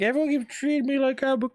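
high, whiny mock kid voice. Mocking a bratty kid character he hates, whiny. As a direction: sneer it like a bratty teen. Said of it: "It's like".

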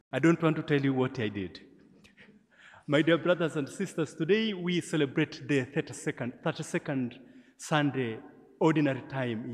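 normal talking voice

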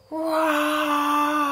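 high, loud lion roar; a big animal roar. Acted. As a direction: roar as loud as you can. Lion roars loudly.

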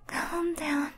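very soft, breathy calm down; hushed self-soothing. Acted. Panicking admirer talks to themself, hushed self-soothing. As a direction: soothe yourself under your breath.

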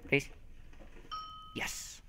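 ordinary delivery

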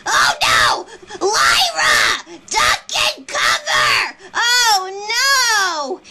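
panicked cries. Acted. Warning Lyra to duck, frantic yelling. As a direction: yell to a friend to take cover.

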